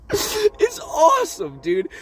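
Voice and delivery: high, slow laugh-cry; squeezed out through giggles; laughing so hard it breaks. Real, not acted. Still laughing about the scene.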